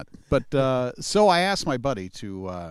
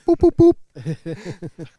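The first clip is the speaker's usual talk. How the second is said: buzzer noise; 6 semitones higher, 11 dB louder, much slower than usual. Pretend. Mock quiz buzzer.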